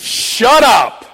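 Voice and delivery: loud, high snap of shut up; angry shout. Retold or imitated. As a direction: bark it at the preacher. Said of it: "he goes".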